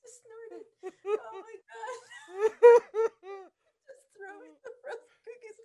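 high, squeaky words through laughter; helpless real laughter. Real, not acted. Listener cracking up at the cookie story, helpless laughter. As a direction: laugh so hard the words barely get out.

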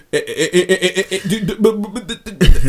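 mock stutter. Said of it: "and he would get him".

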